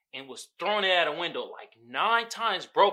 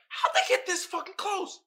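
disbelief; 8 semitones higher than usual. Retold or imitated. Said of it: "I was like".

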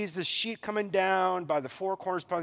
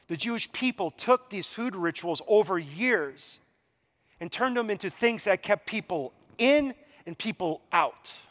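ordinary delivery

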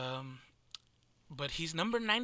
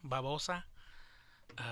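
ordinary talk

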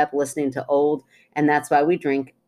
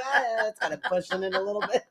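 laughing; 5 semitones higher, 6 dB quieter, faster than usual. Retold or imitated. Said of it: "I was like".

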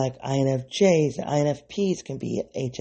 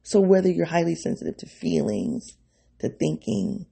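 ordinary talk, nothing marked

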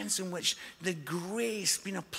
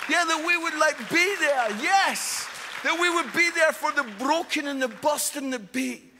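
louder, high impassioned appeal; rousing preaching; 5 semitones higher, 4 dB louder than usual. Acted. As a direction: lift the congregation with fervour. Preaching build to climax.

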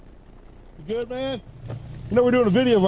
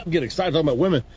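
ordinary talk, nothing marked

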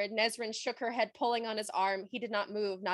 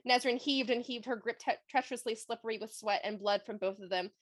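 normal talking voice

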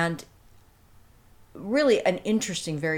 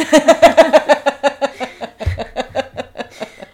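loud delighted laughing, much higher; hearty laughter; 10 semitones higher, 13 dB louder than usual. Real, not acted. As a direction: burst out laughing at the story. Laughs at own diva anecdote.

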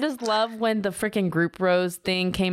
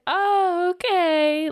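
high, louder, caught off guard; startled little comeback; mock surprise; 10 semitones higher, 3 dB louder, much slower than usual. Christina caught off guard by Brooklyn, mock surprise. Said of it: "And Christina's just like".

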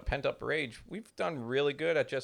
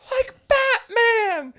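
drawn-out high wailing, much louder; mock drugged sobbing; 11 dB louder, much slower than usual. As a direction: wail it out like a dazed crying kid. Drugged kid retold, crying.

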